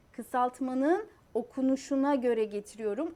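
normal talking voice

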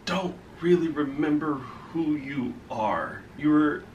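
quiet. Acted. Telling console it's forgotten.

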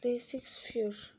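slow whispered reading; whispered read of screen text. Real, not acted. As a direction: breathe the words out slowly.

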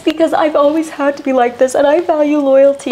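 hammed-up self-justifying; mock Jen. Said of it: "She's like".

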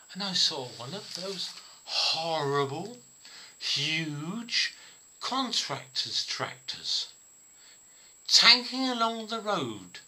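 emphatic, drawn-out indignation, acted; pointed disapproval. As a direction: build up to a pointed accusation. Partner's accusing report of the tractor.

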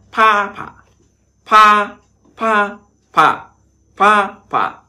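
"Pha phả" high and loud, level then dipping tone; teacher drilling "Pha phả" up high, falling on the second syllable; Mandarin tones on bố. Shown as a demonstration. Teacher drilling the class on the word bố, high and loud. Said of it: "Bố nhá".

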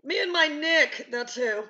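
high, drawn-out shouted retort; loud defiant comeback. Acted. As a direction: belt it back at the challenger. Acted comeback to imagined challenge.

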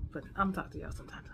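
fast, unvoiced whisper; whispered sign-off. Real, not acted. Vlogger trailing off her goodbye to viewers, whisper. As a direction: rush the goodbye out under your breath.

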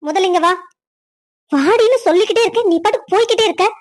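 put-on high-pitched scolding of a girl who ignores her; angry scolding. Stepmother scolds the girl walking away, angry and high.

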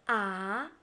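"a" climbing like a question; second tone. Shown as a demonstration. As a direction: lift "a" upward from a low start. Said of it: "four different tones in Chinese pinyin".

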